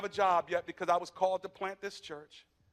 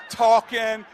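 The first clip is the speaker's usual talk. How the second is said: normal talking voice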